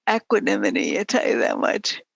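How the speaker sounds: ordinary talk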